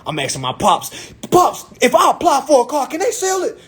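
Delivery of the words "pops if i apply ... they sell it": high, urgent questioning; urgent son. Retold or imitated. Son anxiously asking pops for advice, high and urgent. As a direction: act out a worked-up plea for an answer.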